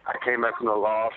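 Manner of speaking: normal talking voice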